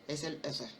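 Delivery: ordinary talk, nothing marked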